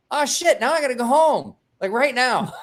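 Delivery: high, loud panicked rush; sudden alarm, gotta leave now. Retold or imitated. To himself after popping the edible: high, loud panic.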